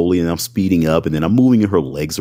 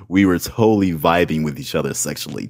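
usual speaking voice

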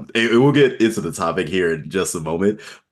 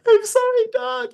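much higher squeaky apology; high-pitched voice. Retold or imitated. Narrator quoting rider's apology in high-pitched voice. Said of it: "Speaking in a high-pitched voice".

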